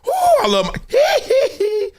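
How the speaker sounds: very high falsetto squeal with hee hee. Retold or imitated. [name] impression, high squeal.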